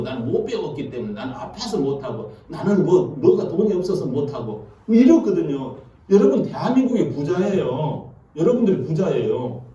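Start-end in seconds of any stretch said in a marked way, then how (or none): 0.0-4.7 mock excuses. Excuses of believers mocked.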